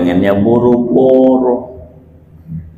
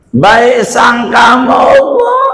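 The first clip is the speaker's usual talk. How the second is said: fervent cry; 9 semitones higher than usual. Real, not acted. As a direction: cry it out with fervour. Preacher cries out the point. (seruan lantang penuh haru)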